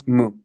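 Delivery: "mu" with a French u, lips rounded as for u while saying i. Shown as a demonstration. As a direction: shape the lips for u but say i in "mu". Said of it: "es como la U francesa".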